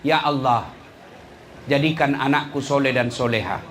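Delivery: put-on hushed prayer for a pious child. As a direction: pray gently over the child. Baru kau berdoa.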